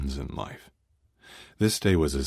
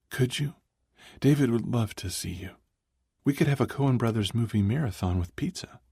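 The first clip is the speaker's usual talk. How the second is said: female voice; 8 semitones higher than usual. Acted. Tiffany voiced, hopeful.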